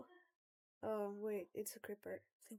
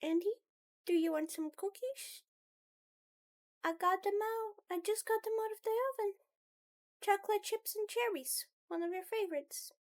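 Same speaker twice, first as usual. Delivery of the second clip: higher, lightly sweet offer; cookie-bearing character; 10 semitones higher, 4 dB louder, faster than usual. Acted. Someone offering Andy cookies fresh from the oven, high voice. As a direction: lift the voice, quick and eager.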